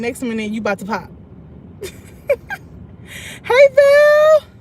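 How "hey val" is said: very high, loud hello to a friend; excited greeting. Real, not acted. Streamer greeting a viewer, high and loud. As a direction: squeal the name out to a fan.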